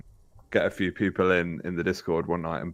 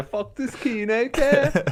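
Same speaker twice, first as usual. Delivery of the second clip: put-on high squeaky Liverpool accent; Scouse accent, pitched way up; Kuno accent; slower than usual. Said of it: "you could just do the Kuno accent".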